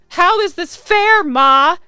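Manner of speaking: put-on loud, high teenage outburst at mom; dramatic scream of indignation; screaming at mother. A narrator's reading. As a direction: yell it in high-pitched protest. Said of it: "I scream at my mother".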